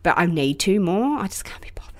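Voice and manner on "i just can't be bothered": low whispered admission; tired, hushed aside; weary confession. Real, not acted. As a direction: let it fade into a guilty whisper.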